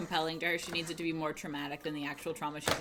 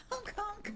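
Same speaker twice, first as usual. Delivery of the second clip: honking noise; 13 semitones higher, 5 dB quieter than usual. Retold or imitated. Imitating car horn in car.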